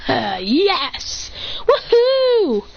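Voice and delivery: triumphant yes rising into a woohoo; elated cheer. Acted. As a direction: celebrate a big win. Celebrates mastering the technique.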